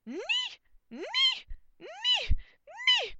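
repeated high piercing yelps; loud squeals, one after another; shrieked knight's cry. A narrator's reading. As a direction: fire off shrill comic falsetto shrieks. Knights attack with their word.

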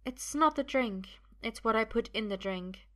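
high, measured woman's voice; high, deliberate confession; calm poisoner. Acted. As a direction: reveal it coolly in a high voice. Sophia reveals the drugging.

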